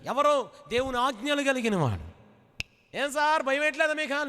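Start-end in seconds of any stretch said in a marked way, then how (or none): 3.0-4.3 high, needling taunt; mocking neighbour. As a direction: poke fun at his calm.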